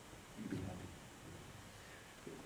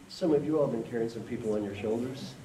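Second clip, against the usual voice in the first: usual speaking voice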